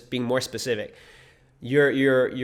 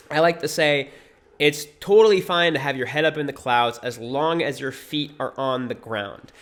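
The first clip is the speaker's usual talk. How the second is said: usual speaking voice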